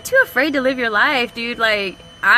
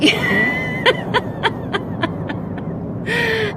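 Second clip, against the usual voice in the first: high breathless laughter; 12 semitones higher than usual. Real, not acted. Laughing fit on stream.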